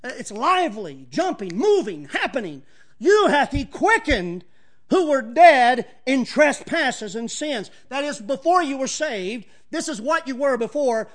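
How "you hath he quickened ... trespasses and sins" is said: emphatic scripture reading. Real, not acted.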